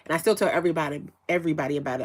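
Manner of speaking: ordinary talk, nothing marked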